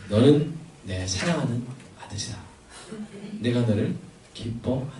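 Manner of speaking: God's voice. Acted. Preacher voicing God speaking to his son, low and soft. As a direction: speak as God, hushed and solemn. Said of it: "하나님이 하신 말씀".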